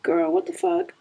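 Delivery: mock disbelief at her own mood; exasperated self-talk. Talking to herself about crying for no reason, incredulous. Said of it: "And it's just like".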